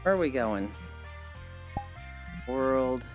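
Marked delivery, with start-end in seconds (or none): none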